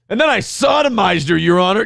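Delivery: hammed-up boasting client; bragging shout. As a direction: yell the confession with pride. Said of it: "Ashton voice".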